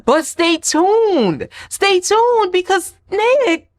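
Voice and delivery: high, slow drawn-out tease; playful stay tuned; teasing cliffhanger. Real, not acted. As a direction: dangle the suspense. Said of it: "teasing cliffhanger".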